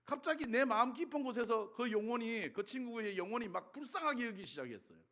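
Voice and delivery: ordinary talk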